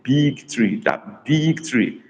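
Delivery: "big" stretched out and leaned on; stress on big. Shown as a demonstration. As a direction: draw out the word "big" to make it stand out. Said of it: "I emphasize the word big".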